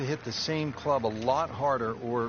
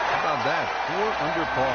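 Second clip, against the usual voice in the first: real excitement, higher and louder; excited; 4 semitones higher, 4 dB louder than usual. Real, not acted. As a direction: call it out with a lift. Birdie celebrated on live broadcast.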